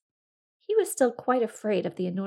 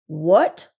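startled bear; 3 semitones lower, 11 dB louder than usual. Acted. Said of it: "said the bear".

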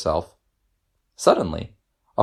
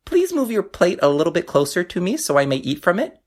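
high, small frog voice; squeaky storybook frog; little frog voice; 10 semitones higher than usual. A narrator's reading. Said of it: "he said".

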